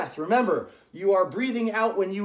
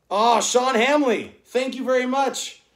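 excited thanks; 4 semitones higher, 6 dB louder than usual. Real, not acted. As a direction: thank a donor with delight. Thanks a donor on the stream.

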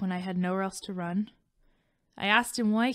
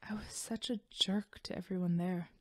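guilty whisper; 8 dB quieter than usual. Acted. Destiny confesses guilt to Ray.

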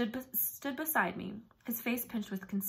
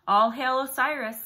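loud ringing hail; crying out; 4 semitones higher, 11 dB louder than usual. A narrator's reading. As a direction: proclaim it to the hall. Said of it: "Set cried".

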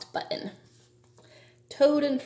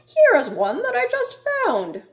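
very high, loudish little animal voice; high-pitched storybook raccoon; 14 semitones higher, 5 dB louder than usual. A narrator's reading. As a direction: offer help in a tiny critter voice. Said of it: "A raccoon came from behind a tree".

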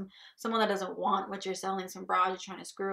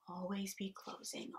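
softly stated sales motto; quiet slogan; 9 dB quieter than usual. Acted. Boss teaching salesmen the motto, quietly. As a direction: say the motto quietly, holding back.

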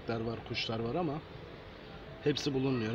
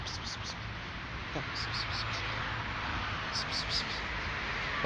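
whispered cat call; 5 dB quieter, slower than usual. Acted. Calling cats softly.

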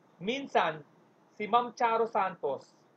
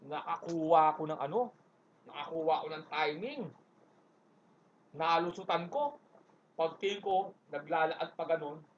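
usual speaking voice

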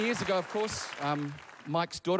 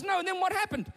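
higher, urgent acted dad; pressing dad; 14 semitones higher than usual. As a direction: press for the story as an anxious father, pitch climbing. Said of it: "Oh, Mike goes".

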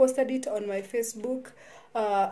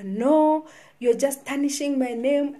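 louder, higher indignant "No"; angry protest; 3 semitones higher, 6 dB louder than usual. Retold or imitated. The lady accuses her of tarnishing her name, loud and indignant. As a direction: retell her outburst with a sharp loud "No".

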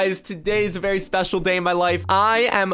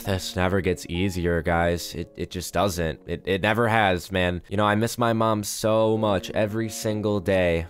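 subdued grief; 7 semitones lower than usual. Pretend. Son mourning his mom at her grave, subdued grief. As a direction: speak low, missing someone dearly.

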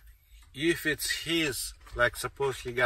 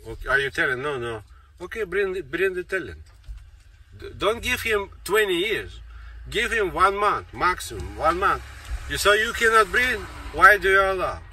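regulator's questioning, rising higher and louder; demanding regulator; 5 semitones higher, 5 dB louder than usual. Acted. Speaker acts out how regulators should question claimants, demanding, increasingly forceful. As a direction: press for answers, building to loud, high demands. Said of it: "they need to talk like this".

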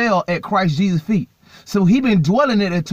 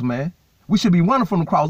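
ordinary delivery

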